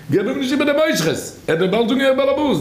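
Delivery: very high, sustained exclamation; acted warning cry.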